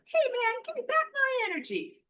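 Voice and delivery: very high and loud cartoon squeak; hammed-up little figure demanding its energy back; silly mock voice. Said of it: "I have to be a little silly".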